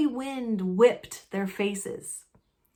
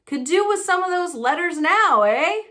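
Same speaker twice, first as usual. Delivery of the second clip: loud, higher, mocking cheer; cheerful Uncle Vernon; 8 semitones higher, 6 dB louder than usual. Uncle Vernon taunting Harry about the letters, loud and gleeful. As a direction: rub it in, delighted. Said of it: "he said cheerfully".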